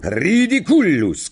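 high, drawn-out incantation; shouted spell. A narrator's reading. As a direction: shout the spell boldly, stretching it. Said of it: "krzyknął".

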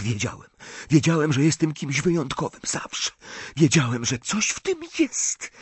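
put-on high, hushed boyish rush; breathless awe, talking to himself; hushed excitement. A narrator's reading. Riddle realises he always was special, hushed and excited. Chłopiec szepcze w uniesieniu.

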